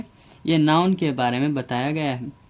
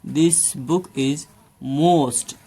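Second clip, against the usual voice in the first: slow dictation; much slower than usual. Shown as a demonstration.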